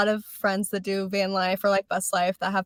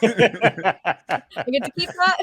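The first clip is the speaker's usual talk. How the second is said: laughing, slow; 2 semitones higher, 3 dB louder, much slower than usual. Retold or imitated. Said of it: "And it comes with the projector".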